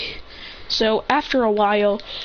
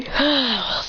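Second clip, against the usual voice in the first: audible breathed-out sigh; contented sigh; 4 semitones higher than usual. Acted. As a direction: breathe out before speaking. Character sighs before greeting the day.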